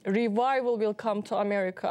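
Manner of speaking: ordinary delivery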